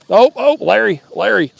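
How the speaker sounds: sudden high excited shout of the name; excited call. Real, not acted. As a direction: call out thrilled as the fish bites. Fish bites during live stream.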